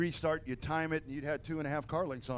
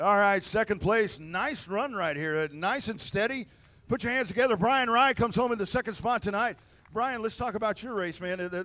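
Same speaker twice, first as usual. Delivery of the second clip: race announcer; 4 semitones higher, 6 dB louder than usual. Acted. PA announcer introduces runner-up.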